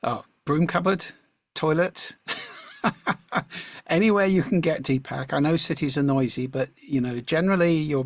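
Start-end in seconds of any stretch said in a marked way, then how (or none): 2.2-3.8 breathy high burst of real laughter. Real, not acted. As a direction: laugh at your own joke. Laughs at own toilet suggestion.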